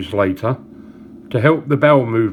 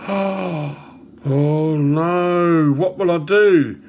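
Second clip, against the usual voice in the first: mock-horrified gasp, then drawn-out dismay; hammed-up horror from a joke character; aghast; 3 semitones higher, much slower than usual. Rabbit told the toasties are out, hammed-up aghast. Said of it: "The rabbit looks aghast".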